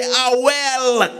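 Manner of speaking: put-on high-pitched old woman voice; old lady's reply. Old lady answering a morning greeting, high voice. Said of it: "She say".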